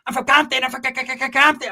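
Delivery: high, stammering, high-as-a-kite voice; high wired Pepe. Retold or imitated. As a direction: stutter out where you're from, wired. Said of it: "Hey, Pepe was like".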